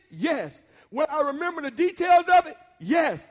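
preacher's call and answer; preaching at full force. Acted. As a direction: build the church up to a shout.

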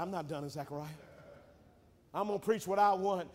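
ordinary delivery

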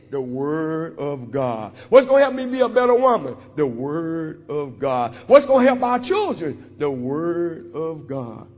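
low, slow answer to each rising question; call-and-response preaching. Acted.

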